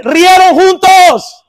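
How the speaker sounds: very slow, high and loud "rieron juntos"; drawn-out question. Acted. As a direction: stretch the question to make it land. Preacher presses the congregation. (pregunta estirada, muy lenta, alta y fuerte)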